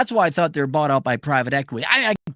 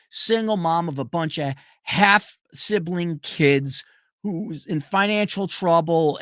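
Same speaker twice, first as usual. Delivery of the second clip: normal talking voice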